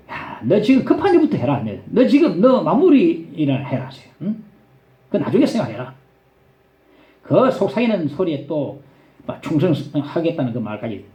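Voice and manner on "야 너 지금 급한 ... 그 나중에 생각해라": ordinary delivery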